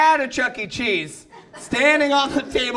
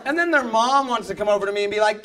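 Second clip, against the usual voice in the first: normal talking voice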